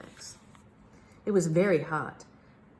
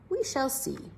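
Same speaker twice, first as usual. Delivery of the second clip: higher, knowing reply; lightly voiced Big Max; 9 semitones higher than usual. A narrator's reading. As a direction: answer with a knowing lift. Big Max answers the king.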